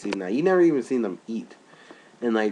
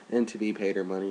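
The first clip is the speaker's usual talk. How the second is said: ordinary talk, nothing marked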